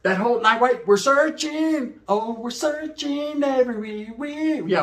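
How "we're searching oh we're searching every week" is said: very slow, high stretched words; drawn out. Retold or imitated. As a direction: stretch each word long. Drawn out searching.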